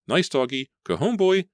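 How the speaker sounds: high, loud, strained pleading; desperate coaxing. A narrator's reading. Claudia pleading with the wolfhound, high frightened coaxing. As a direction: beg the dog to leave, terrified.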